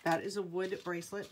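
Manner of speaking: normal talking voice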